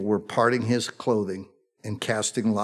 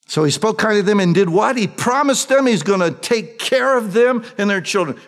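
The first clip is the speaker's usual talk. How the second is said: higher, louder preaching with rising fervor; impassioned preaching; 9 semitones higher, 6 dB louder than usual. Acted. Preacher urging the congregation, loud and fervent.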